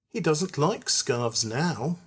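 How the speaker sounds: plain reading voice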